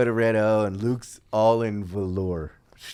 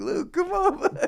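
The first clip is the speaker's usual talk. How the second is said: very high, laughing come on; laughing coaxing. Retold or imitated. Wee Man retelling cheering up Luke, laughing high. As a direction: coax him through giggles. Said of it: "And I'm like".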